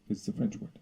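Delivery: usual speaking voice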